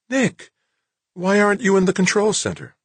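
high, surprised woman's voice. Acted. Claire startled to see Nick, surprised and high.

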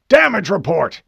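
loud, high commander's yell; urgent shouted demand. A narrator's reading. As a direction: shout over blaring alarms. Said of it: "he yelled".

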